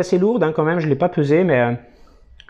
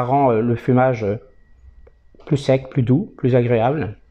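talking around pipe stem; pipe in mouth; 3 semitones lower than usual. Real, not acted. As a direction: lips clamped on pipe. Seen 1: Pipe stem clenched between lips throughout; smoke exhaled at 0.9s.

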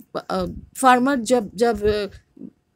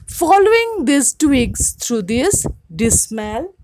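raised, lively read-aloud voice for the class; story reading; 3 semitones higher, 6 dB louder than usual. A narrator's reading. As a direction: read the folktale out to students with expression. Teacher reads story aloud.

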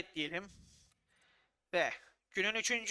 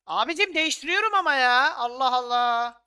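loud, higher exasperation; frustrated outburst; 4 semitones higher, 10 dB louder than usual. Real, not acted. Manager loudly frustrated as the substitution won't work. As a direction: complain at the broken menu.

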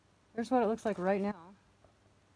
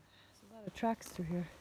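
ordinary delivery

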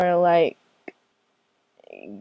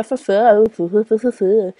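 ordinary talk, nothing marked

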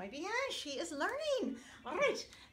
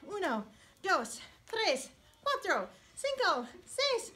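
building salsa count; 7 semitones higher, 4 dB louder, slower than usual. Acted. Teacher counting a salsa dance from steady to loud. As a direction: count that climbs higher and louder.

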